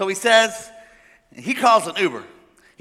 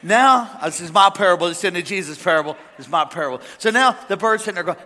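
usual speaking voice

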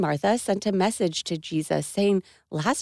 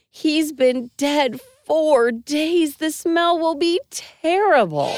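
protesting Martha; 5 semitones higher, slower than usual. Acted.

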